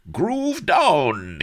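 high, loud party whoop from a fat viceroy; cried out. Acted. Viceroy dancing wildly, high loud whoop. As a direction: whoop it up mid-dance. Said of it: "he cried as he shook revoltingly".

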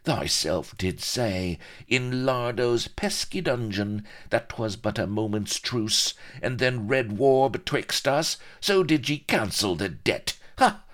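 sneering mockery. Pirate throws captain's words back at him, sneering mockery. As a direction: taunt him, pitch soaring on the scoffs. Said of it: "all lean and sneering mockery".

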